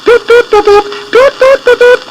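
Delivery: tiny high peeping chicks; chick voices. A narrator's reading. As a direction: squeak it like little chicks. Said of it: "the little chickens answered".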